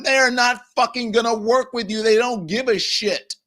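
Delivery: high-pitched, emphatic angry rebuttal; exasperated outburst. Real, not acted. Host telling Democrats that rivals will never cooperate, exasperated outburst. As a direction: burst out in frustration at their naivety.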